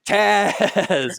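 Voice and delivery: loud, high, stretched-out cry; drawn-out mock shout. Host jokingly hollering the character's name, drawn-out shout. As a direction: playfully holler the name out long.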